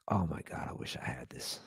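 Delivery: very soft, low, fading to a near whisper; retold under-the-breath wish; hushed aside. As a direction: murmur it to yourself, as if privately marveling. Retells private wish mid-conversation.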